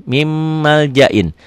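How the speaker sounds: slow "Mim maljain", the m hummed long; idgham with ghunnah. Shown as a demonstration. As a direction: sustain the closed-lip m of "Mim" into "maljain". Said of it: "disertai dengan dengung".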